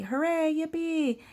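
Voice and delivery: high-pitched mock cheer; happy pigs cheering. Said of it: "like, happy".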